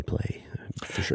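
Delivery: slow whispered joke aside; hushed teasing aside. Real, not acted. Joking aside to guest.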